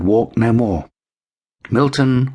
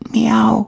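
high pleading mew; a small cat's meow, much higher; kitten meow. A narrator's reading. Kitten mewing to the big cats.

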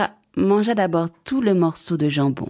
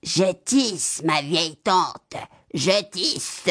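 gruff voice; much slower than usual. Acted. The cat pretends to be the girl answering Baba Yaga, gruff voice. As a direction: answer in a put-on gruff voice to fool her. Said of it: "répondit le chat d'une grosse voix".

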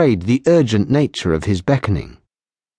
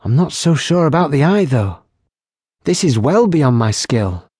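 put-on higher, lively young barmaid voice; teasing barmaid; 5 semitones higher than usual. Barmaid teasing the lieutenant about her healing, higher and playful. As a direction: tease them playfully, brightly pitched. Kylie doubts.

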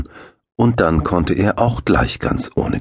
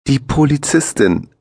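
amused surprise; 10 semitones higher than usual. A narrator's reading. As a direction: laugh off the silly question. Axel amused by the question.

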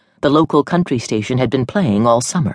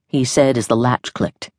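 ordinary delivery

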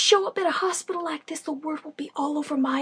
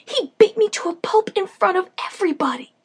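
high, loud disbelieving outburst; are you out of your mind; incredulous protest; 7 semitones higher, 5 dB louder than usual. Acted. Battered friend protests in disbelief.

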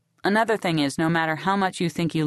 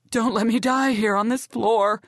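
Brandy pleading; 5 semitones higher than usual. Acted.

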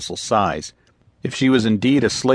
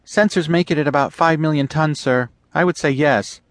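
put-on higher, eager young officer voice; eager lieutenant; 8 semitones higher than usual. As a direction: report the find with keen urgency. Lieutenant estimates cargo.

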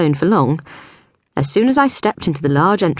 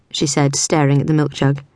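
plain reading voice

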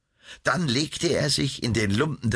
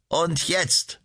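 feigned wounded slave; 7 semitones higher than usual. A narrator's reading. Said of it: "sagte der todwunde Sklave".

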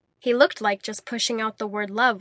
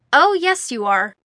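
defiant; 4 semitones higher, 8 dB louder than usual. A narrator's reading. Defiant exit line.

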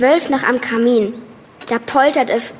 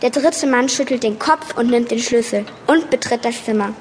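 normal talking voice

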